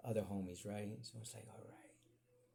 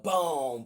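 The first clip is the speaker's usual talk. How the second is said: very loud boom; mock boom; 3 semitones higher, 13 dB louder than usual. Pounding on the bully, loud boom. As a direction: slam out the hit sound. Impact sound effect.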